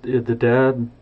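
plain reading voice

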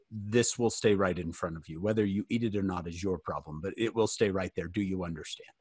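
low, controlled stern father; lightly played dad ultimatum, softening at the end. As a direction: lay down the law, low and measured. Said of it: "I had to use my dad voice".

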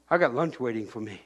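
ordinary talk

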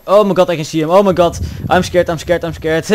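loud, rapid-fire scared repetition; panicked. Real, not acted. Player spots the pursuer in game, panicked. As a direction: blurt it out in a panic.

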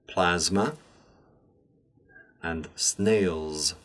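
the S in "Bags" through "snails" voiced as a buzzing Z; word list with pauses, "hands", "coins", "films" ending in zzz; Z sound for S. Shown as a demonstration. Teacher giving examples to repeat, S said as Z from "Bags" to "snails". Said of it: "words which contain a Z sound".

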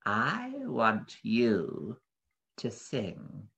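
very slow, creeping monster voice; soft, slithery beast. A narrator's reading. Beast commanding the parrot to sing, slowly and slitherily. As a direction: draw each word out like a sliding snake. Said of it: "beast's voice was soft and slithery".

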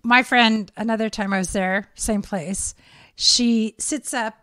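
ordinary talk, nothing marked

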